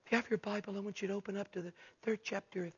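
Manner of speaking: very hushed mock preacher; soft-spoken imitation. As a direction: mimic a timid preacher who barely projects. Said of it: "Some real soft-spoken guys".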